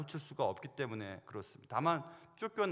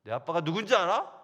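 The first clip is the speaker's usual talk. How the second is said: loud challenge; 3 semitones higher, 9 dB louder than usual. Pretend. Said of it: "그렇게 말할 작정이에요".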